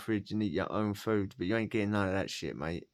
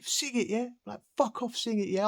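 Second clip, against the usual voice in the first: high pushy prison-window taunt; taunting inmate; 13 semitones higher than usual. Retold or imitated. Inmates demand a song at the window, high taunting voice. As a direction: push him high to sing. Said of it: "So, like".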